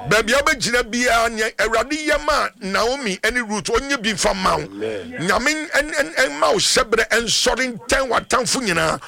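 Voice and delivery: fervent prayer. Real, not acted. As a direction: proclaim the blessing with conviction. Prays for a caller on air.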